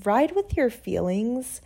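usual speaking voice

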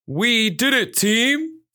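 high, proud team call; dog officer Ajax. A narrator's reading. Police dog to his team after the find, high celebrating voice. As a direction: pitch it up and rising, celebrating. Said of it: "his voice steady".

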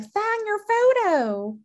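high, drawn-out mock demand; imagined creepy fan. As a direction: stretch it out high as a pretend fan. Voicing an imagined creepy fan's demand.

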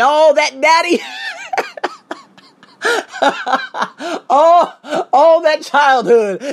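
high, loud burst of laughter mid-rant; laughing. Real, not acted. Speaker joking to listeners about childhood coming out, laughing.

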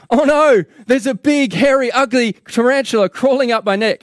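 loud, high-pitched cry of alarm; acted panicked scream. Newspaper reader feels the tarantula on his neck, acted panicked scream. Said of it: "And so he screams".

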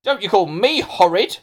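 indignant schoolboy. Acted. Hamish answers Melanie back, indignant.

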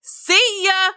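high, hollered goodbye; high-pitched creature shout. A narrator's reading. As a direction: call out a cheery monster farewell. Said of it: "Mo shouted loud enough".

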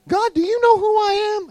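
squeaky high-pitched self-pity; mock pleading prayer voice; high plea to God. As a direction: whine it up high like a kid. Pastor voices his own prayer.

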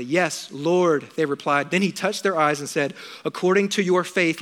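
quiet reverent Bible reading; scripture reading. Acted. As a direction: read the story reverently to the congregation. Reads scripture aloud.

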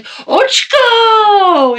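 very high, loud drawn-out shout of "Ochko"; yelling his nickname. Retold or imitated. Calling down the hall to Steve with a long high yell. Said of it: "when I see Steve, I'll be like".

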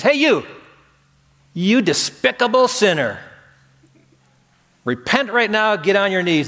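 hammed-up fire-and-brimstone condemnation; mock condemning call. Preacher mock-shouting at Zacchaeus in the tree, scornful.

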